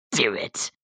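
low sinister urging; lightly voiced scheming villain; Palpatine. Villain in the corner urging Anakin to kill, low sinister voice. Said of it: "Palpatine is, like, in the corner".

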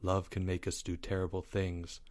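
very soft, low and grave. A narrator's reading. Leo responds to Albert's confession, in a low voice. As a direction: drop the voice low and hushed. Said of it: "said Leo in a low voice".

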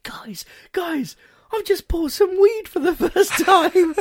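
very high mock-excited announcement; high-pitched, giddy impression; Lucianus. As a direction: imitate a friend thrilled with himself. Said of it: "Lucianus turns to us all and goes".

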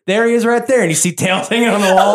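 a gleeful Southern shopkeeper bragging; Southern shopkeeper accent. Acted. I'm regretting the Southern accent thing.

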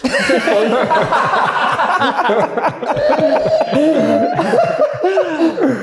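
long high burst of laughter; real belly laugh at his own joke; helpless laughter. Real, not acted. After own joke name.

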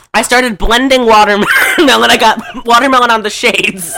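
loud, helpless giggling through the words; real laughter breaking up the story; laughing while telling. Real, not acted. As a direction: struggle to get it out through laughter. Cracking up telling drunken mishap.

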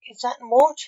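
high, loud chicken chirp; chirpy. A narrator's reading. Chicken on seeing the reaper walk in, chirping. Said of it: "the chicken chirped".

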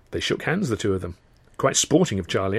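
ordinary delivery, nothing marked

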